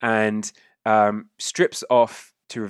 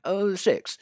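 a hint of a higher, unimpressed critic; mildly voiced lukewarm praise; lightly voiced Nobby; 4 semitones higher than usual. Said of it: "Nobby just goes".

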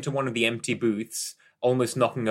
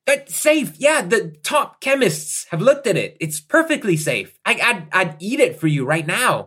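higher, louder salesman insisting; pushy reassurance; 10 semitones higher, 7 dB louder than usual. Acted. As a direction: brush off her doubts with bluster.

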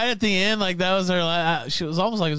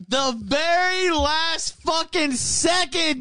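ordinary talk, nothing marked

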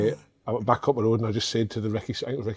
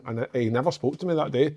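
ordinary talk

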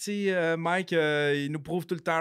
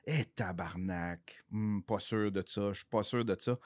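retold doubtful reaction; 6 semitones lower, 7 dB quieter than usual. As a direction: mull it over quietly, imitating private doubt. Acting out doubt at Mike's decisions.

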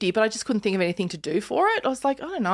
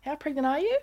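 higher, soft, mock-suspicious questioning; suspicious neighbour; 7 semitones higher, 3 dB quieter than usual. Neighbour working out the dates of the pregnancy, in a mock-suspicious voice. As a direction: play the nosy neighbour counting the months. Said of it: "he might go".

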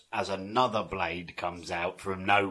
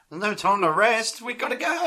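mock heroic Indy; 12 semitones higher than usual. Indy rallying his tired gang.

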